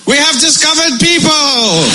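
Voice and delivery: slow, high, grand proclamation; hammed-up colonial announcer, drawn out; pompous British explorer. Explorer to the locals, pompous slow proclamation.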